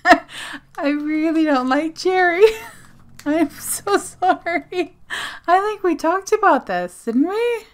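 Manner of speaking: high, loud laughing apology. Real, not acted. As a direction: giggle through the apology. Laughing apology about cherry.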